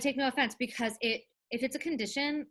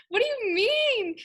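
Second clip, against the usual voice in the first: mock bewildered cry; 4 dB louder, slower than usual. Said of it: "I was like".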